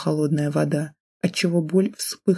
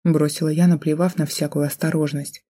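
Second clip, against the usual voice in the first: ordinary delivery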